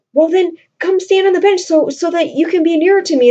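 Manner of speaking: mock swooning young woman; coaxing Roxanne. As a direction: coax him closer, lovestruck. Teacher voices Roxanne's coaxing request.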